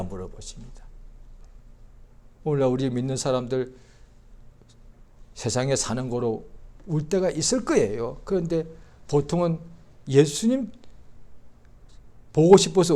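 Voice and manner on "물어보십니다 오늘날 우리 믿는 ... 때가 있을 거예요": usual speaking voice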